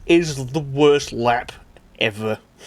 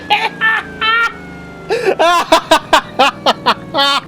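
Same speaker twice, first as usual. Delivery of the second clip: high, loud real laughing fit; helpless laughter; 6 dB louder than usual. Real, not acted. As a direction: crack up at the mess. Laughing at broken car.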